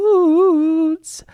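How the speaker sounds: very high, loud stretched call; playful wailing sign-off; drawn-out mock holler. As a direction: hold the word long and loud, way up high.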